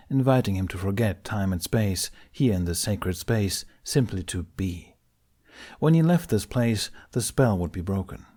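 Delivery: usual speaking voice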